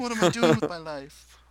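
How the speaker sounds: loud, high mock despair; despairing listener. Host joins the imagined listener bit, loud high mock despair. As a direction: play a listener loudly lamenting wasted years.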